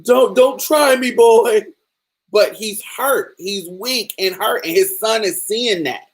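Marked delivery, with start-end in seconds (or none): 0.0-1.6 high, loud tearful threat from a beaten father; crying threat. Retold or imitated. Father threatening his son, high loud crying threat. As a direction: crack into a high, loud, weepy warning. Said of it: "He was crying and was like".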